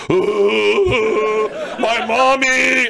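high, loud drawn-out mock wail; mock crying. Comic imitating dad and uncle at the casket, exaggerated sobbing. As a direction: bawl it like a grown man, hammed-up. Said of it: "they just start crying like men".

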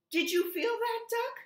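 high, loud, thrilled; excited gasp. A narrator's reading. Goose asks Duck, high excited squeal. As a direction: squeal it out with wonder. Goose feels egg move.

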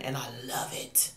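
breathy whispered I love it; hushed tender whisper. Real, not acted. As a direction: whisper it to yourself, devoted. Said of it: "whispered devotion".

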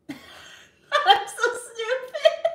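high-pitched words squeezed through laughter; real laughing fit. Real, not acted. Streamer laughs at their own cartoon impression, helpless high laughter. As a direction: crack up while talking.

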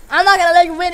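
usual speaking voice

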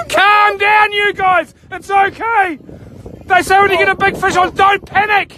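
yelling. Real, not acted. Angler urging the crew not to panic, yelling. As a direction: shout reassurance in a flap. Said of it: "Why are you two yelling".

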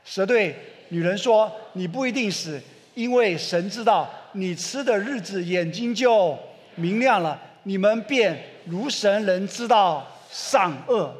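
slow group reading of Genesis; scripture recited together. Acted. Preacher leading the church in reading the serpent's words, slow recitation. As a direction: read it slowly, all together. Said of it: "来，一起来念，来".

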